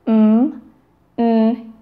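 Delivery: m and n hummed through the nose, each alone; isolated, sustained m and n sounds; nasal consonant sounds. Shown as a demonstration. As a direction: hold m, then n, as nasal hums. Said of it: "The nasal consonants include".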